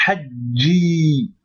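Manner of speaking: hajji with the j held long for the shadda; teacher demonstrating shadda in hajji; doubled consonant. Shown as a demonstration. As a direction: lean on the doubled j in hajji, slowly. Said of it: "il y a une shadda".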